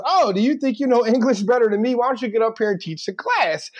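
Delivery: high-pitched mock indignant teacher; imitated challenged teacher. Teacher dares the student to take over, mock indignant. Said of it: "so he says".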